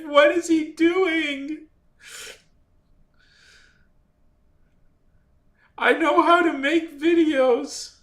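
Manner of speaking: high, strained, drawn-out protest; slow wail of wounded professional pride; exasperated self-talk. Retold or imitated. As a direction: plead with yourself in disbelief. Retells frantic talk to himself.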